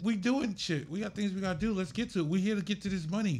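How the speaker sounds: high-pitched, driving acted pep talk; aggressive, harsh. Manager brushing off an artist's feelings, aggressive. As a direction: push them briskly to get moving. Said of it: "I'm a little more aggressive and, and harsh".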